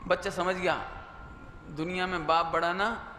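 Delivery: ordinary talk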